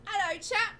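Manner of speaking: put-on high, loud chirpy street kid; cheeky urchin greeting. Street kid Ollie greets the party, high and chirpy. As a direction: pipe it up like a cocky orphan. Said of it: "hits you in the shoulder and says".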